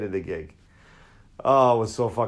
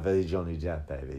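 a much lower, much softer lazy rock-star mumble; 6 semitones lower, 7 dB quieter than usual. Retold or imitated. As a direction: drift it out like a burnt-out rocker. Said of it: "just like".

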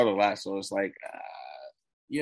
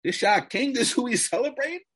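high, louder scoffing questions; incredulous mockery; 12 semitones higher, 4 dB louder than usual. Host mocking fans who celebrate the record, high and scoffing. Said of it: "And I'm like".

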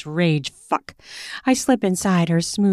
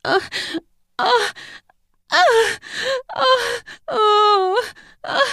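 high whimpering grunts; pouty grunts; slower than usual. Acted. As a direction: grunt in short pouty bursts. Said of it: "her sounds are almost pouty".

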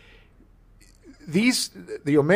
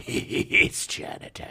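whisper; 4 dB quieter, slower than usual. Pretend. Chinatown movie quote.

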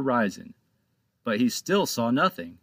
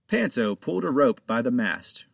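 ordinary delivery

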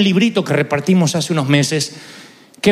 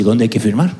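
curt father; 8 semitones lower than usual. Retold or imitated. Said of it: "Lo cortó así".

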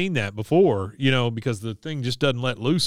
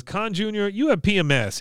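higher-pitched accented mother impression; Min; 8 semitones higher than usual. Mother explaining to her daughter, higher put-on voice. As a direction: imitate a mother's blunt diagnosis, pitched up. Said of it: "Min says".

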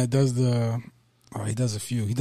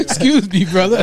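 prank character voice; 6 semitones higher, 6 dB louder than usual. Retold or imitated. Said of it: "and he'll go".

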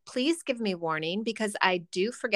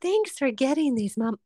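mock kid voice; 5 semitones higher than usual. Kids thanking mom for snacks they won't eat, in a sugary mock child voice. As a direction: play a kid politely faking delight. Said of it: "kids voiced thanking mom".

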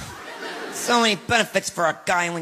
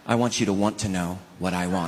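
lower, softer pouting wife; wife's pouty line; 10 semitones lower, 5 dB quieter than usual. Acted. To husband in restaurant, a low sulky pout. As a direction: sulk it to the husband, quietly.

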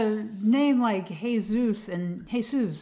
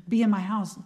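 ordinary talk

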